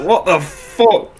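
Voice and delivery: high, slow incredulous what the fuck; mock shock. Seeing the man choked out, high and slow. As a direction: stretch it out in disbelief. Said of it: "I was like".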